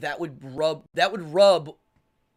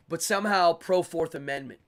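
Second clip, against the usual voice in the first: ordinary delivery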